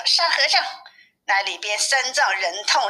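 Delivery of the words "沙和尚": high-pitched call to a companion; narrated shout, pitched well up. A narrator's reading. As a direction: call the name in a raised pitch. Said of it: "叫了一声".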